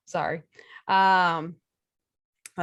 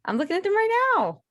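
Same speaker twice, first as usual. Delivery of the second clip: playful protest; 11 semitones higher than usual. Pretend. Mock squabble over having notes.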